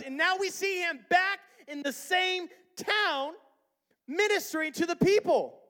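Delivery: high, loud pulpit delivery; preach at full force. Real, not acted.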